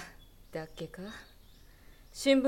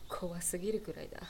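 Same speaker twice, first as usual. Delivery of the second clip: soft, unvoiced ending; whispered unease; 3 semitones lower, 9 dB quieter, faster than usual. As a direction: trail off into a whisper. Musing on the coincidence.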